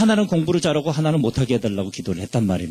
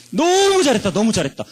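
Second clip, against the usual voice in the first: over-the-top praise; 7 semitones higher than usual. Retold or imitated. Father praising son.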